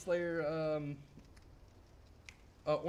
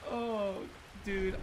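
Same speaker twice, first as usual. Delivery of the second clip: ordinary delivery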